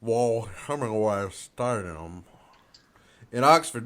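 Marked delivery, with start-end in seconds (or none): 0.0-2.2 slow, stretched Southern drawl on Vaughn Hemingway Stadium. Shown as a demonstration. Repeating the stadium name after being corrected, slow Southern drawl. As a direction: try out a put-on Southern drawl. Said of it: "You have to say it with a pure Southern drawl".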